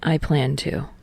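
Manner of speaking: understated reassuring reply; calm and reassuring. Acted. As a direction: say it calm and steady, a quiet promise. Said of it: "a calm and reassuring".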